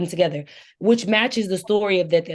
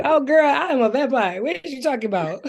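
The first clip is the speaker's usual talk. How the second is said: sassy incredulous comeback; 8 semitones higher than usual. Acted. Acted vampire's comeback amid laughter.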